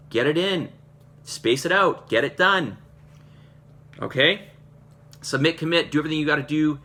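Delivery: emphatic, fired-up motivational push; urgent pep talk. Real, not acted. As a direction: push them hard, punching each command. Coach exhorting group to train.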